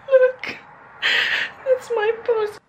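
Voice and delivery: high, loud excited squeal; overjoyed. Real, not acted. Showing viewers her shared post, high excited squeal. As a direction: show it off, barely holding it together. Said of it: "I'm legit crying".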